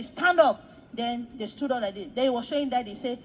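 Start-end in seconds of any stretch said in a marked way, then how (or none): 0.0-0.6 loud, higher order; commanding call. Retold or imitated. Man ordering prosperity preachers up, commanding call. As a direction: call them sharply to their feet.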